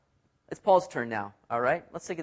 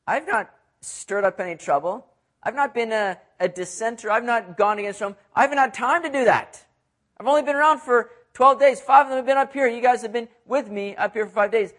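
rising louder and higher in protest; Paul insists he had no time for trouble; Paul's defense building; 6 semitones higher, 6 dB louder than usual. Retold or imitated. Paul defends himself to Felix, rising to loud protest. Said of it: "Paul's saying".

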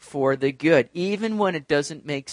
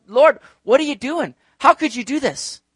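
high, louder mock protest to God; indignant questioning; 8 semitones higher, 4 dB louder than usual. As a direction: act out challenging God in disbelief. Acts out someone questioning God.

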